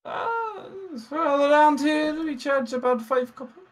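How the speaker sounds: high, flustered barmaid, stammering her welcome; a nervous tavern maid, voice up high and eager. Acted. Barmaid answers price.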